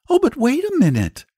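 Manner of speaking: put-on high, eager little girl; acted child's urgent protest; Charlotte. A narrator's reading. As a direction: cry out to hold up a departing storyteller. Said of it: "cried Charlotte".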